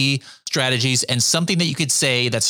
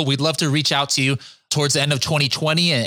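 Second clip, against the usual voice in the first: ordinary delivery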